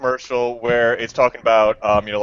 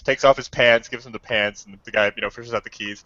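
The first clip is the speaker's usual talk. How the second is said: ordinary talk, nothing marked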